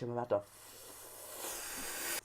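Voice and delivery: slow whispered curse. Retold or imitated. Cursing the kid who exposed him, slow whisper. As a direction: hiss it under your breath.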